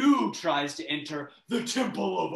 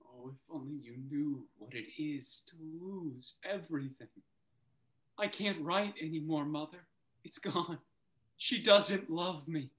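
quiet despair; 9 dB quieter than usual. Acted. Son despairing to his mother.

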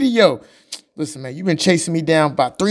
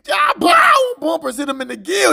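excited fight call; 10 semitones higher than usual. Acted. Narrating a fight scene.

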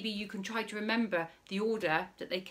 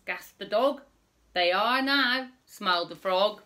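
put-on reading to children; 3 semitones higher, 6 dB louder than usual. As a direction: read it out big for little listeners. Reading rhyming story to children.

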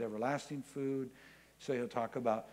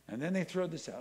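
usual speaking voice

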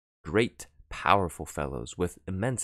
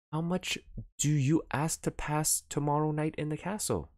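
pleading offer; 10 semitones higher than usual. Acted. Baron pleads with the detective.

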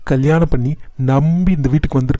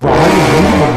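louder angry shout, cutting her off; 6 dB louder, much slower than usual. Acted. Son shouts at his mother to stop insulting Anu's mother, loud and angry. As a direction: bark at her to shut up.

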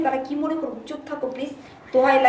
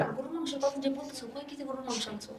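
hushed pleading; 11 dB quieter than usual. Acted. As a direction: keep it down, guests are near. Hushed quarrel with guests near. (বাবা-ভাই পাশে বসা, তাই চাপা গলায় অনুরোধ)

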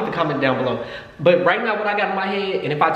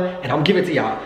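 ordinary talk, nothing marked

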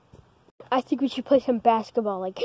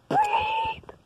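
high sung beep; censor beep. Pretend. Kids faking a censor bleep for dad's swearing, high beep. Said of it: "we're just saying the word beep".

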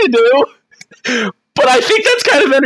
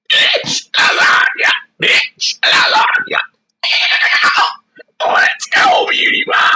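raspy, strained shouting; hoarse voice. Real, not acted. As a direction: shout through a hoarse throat. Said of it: "I sound like a smoker".

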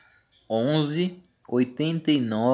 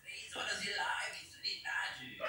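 whispering; 6 semitones higher, 18 dB quieter, slower than usual. Shown as a demonstration. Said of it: "repeated after loud take".

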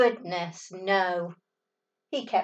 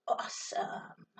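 whispered cool; 13 dB quieter than usual. Acted. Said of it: "and he says".

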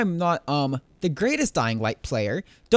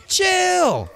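high, louder squeaky "chill!"; high squeal; 13 semitones higher, 5 dB louder than usual. Real, not acted. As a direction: squeal it at the zombie. Calming a zombie.

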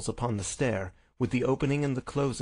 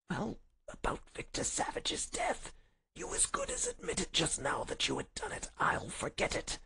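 high, breath-thin, soft pleading; feeble whisper; 10 semitones higher, 6 dB quieter than usual. Acted. Sick Holmes offers to forget the death, feeble whisper. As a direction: whisper it as if nearly spent. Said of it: "he whispered".

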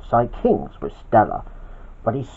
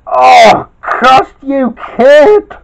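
much higher, louder cursing cry; villain's shout; 10 semitones higher, 10 dB louder, much slower than usual. Acted. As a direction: curse the hero as you lose. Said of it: "shouted Rana".

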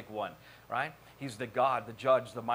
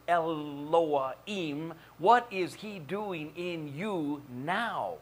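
drawn-out names and deliberate questions; slow, weighty preaching; 2 semitones higher, much slower than usual. Acted. Preacher asking congregation what God is doing in them, weighty preaching. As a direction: stretch each divine name with weight.

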